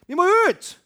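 high, loud insistent shout; loud preacher cry; evangelist shouting. Retold or imitated. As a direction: shout it again, pulling hard. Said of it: "nå er den skakk".

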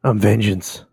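low gravelly vigilante growl; brooding dark hero line; Batman voice. Acted. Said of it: "and from [name]?".

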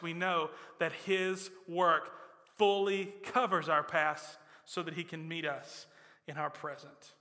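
ordinary talk, nothing marked

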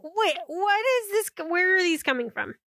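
high, baffled questioning; confused. Retold or imitated. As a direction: sound totally lost. Acting confused in story.

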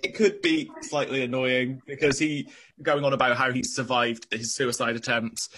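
ordinary talk, nothing marked

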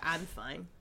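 soft, withdrawn brush-off; mock "I'm fine". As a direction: imitate someone softly denying they're upset. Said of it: "what's worse is the".

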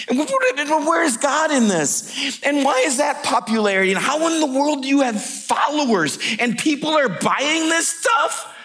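high, rapid-fire indignant questions; exasperated outrage. Acted. Friend railing at the world around him, exasperated outrage. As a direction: build an incredulous rant, pitch climbing.

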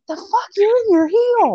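high-pitched incredulous reaction; mock disbelief. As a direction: blurt it way up high, baffled at the character. Voicing a viewer's disbelief.

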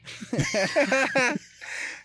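long high laugh; high burst of laughter. Real, not acted. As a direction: cackle at your own story. Laugh after punchline.

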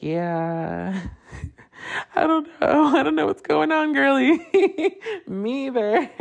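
put-on high, sassy girly reply with giggle; telling mom he doesn't know, high campy girly voice.

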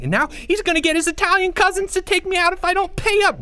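put-on very high, loud, rushing boy voice; high loud panicked child voice; frantic kid. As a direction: pour it out in a frantic rush. Boy rants about bets.

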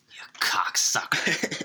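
slow whispered insult; slow hissed mock insult; imitated whispered insult. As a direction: whisper the slur slowly. Quoting Hoffa insult.

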